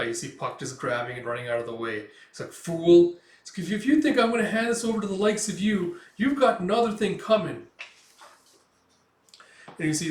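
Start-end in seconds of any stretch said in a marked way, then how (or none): 2.5-3.2 loud, scornful bellow; one loud dismissive word; villain's shout. Retold or imitated. 3.7-7.7 high, loud plucky defiance; cheeky refusal, pitched up; defiant retort. Retold or imitated. Acts Puck defying the Count.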